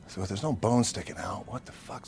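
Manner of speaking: breathy, near-silent curse; whispered. Retold or imitated. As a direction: mutter it under your breath. Whispered bewilderment after injury.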